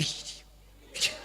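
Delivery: soft hushing sounds; mock shushing. Wife brushing off husband's I love you, soft shush. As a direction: hush him dismissively.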